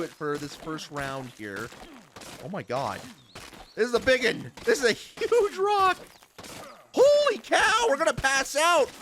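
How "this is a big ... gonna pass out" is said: surprised. Real, not acted. Gasping at a huge rock.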